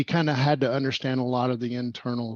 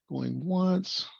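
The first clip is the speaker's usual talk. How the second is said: higher, lilting mock auctioneer; playful auction call; 6 semitones higher than usual. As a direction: prompt volunteers like an auctioneer, lightly.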